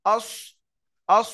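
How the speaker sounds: আস said full and heavy with rounded mouth; heavy full sound. Shown as a demonstration. Teacher drilling the heavy সদ. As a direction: give আস a thick, full sound. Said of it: "সতরাও পুর হয়".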